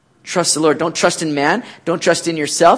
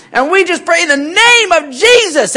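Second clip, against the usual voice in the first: mock televangelist prayer, higher and louder; 10 semitones higher, 5 dB louder than usual. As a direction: ham up a TV preacher praying. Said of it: "You hear televangelists".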